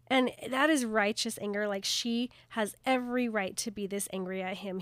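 ordinary talk, nothing marked

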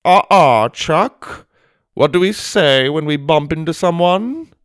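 high, loud, prim reprimand; scolding headmaster. Acted. Headmaster stops boy who shoved Jake, prim scolding. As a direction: quiz him like a child caught misbehaving.

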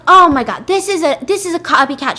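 high, loud exclamation; acted alarmed accusation. Woman denouncing impostor to police, high loud alarm. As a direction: burst out as if exposing a fraud.

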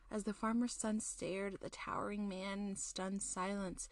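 usual speaking voice

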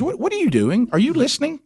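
high, mock-exasperated wife voice; imitating wife. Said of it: "And she goes".

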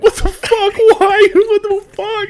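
high, loud mock wail, half laughing; retold anguished outburst. Retelling his nightmare reaction to friend's death, hammed-up wail.

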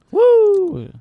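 high, loud cheering whoop; excited whoop. Real, not acted. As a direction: let out a wild party whoop. Cheering the weed kicking in.

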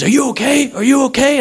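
high, urgent stranger checking on someone; urgent concern. Retold or imitated. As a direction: act out a worried bystander rushing up. Stranger rushing up after crash.

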